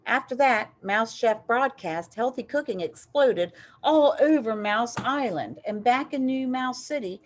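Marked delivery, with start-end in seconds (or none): none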